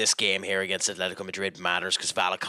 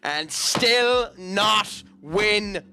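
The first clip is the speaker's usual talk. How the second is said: exasperated outburst; 7 semitones higher, 3 dB louder, slower than usual. Real, not acted. Exasperated rant about losing league.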